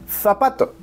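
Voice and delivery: "zapato" opening on a th sound; Castilian Z. Shown as a demonstration. How only forty million would say it, Castilian th. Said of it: "solo a lo mejor unos cuarenta millones".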